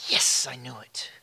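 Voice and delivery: breathy low whisper to himself; hushed self-satisfied whisper. Real, not acted. Whispered aside before the sermon.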